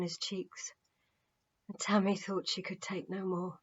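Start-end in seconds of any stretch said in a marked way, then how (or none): none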